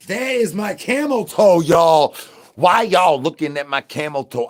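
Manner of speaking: ordinary delivery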